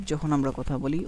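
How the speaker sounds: ordinary talk, nothing marked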